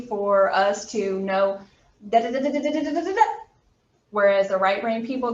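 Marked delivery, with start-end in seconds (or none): 2.1-3.2 rattling off. Shown as a demonstration. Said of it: "will just brrrr through things".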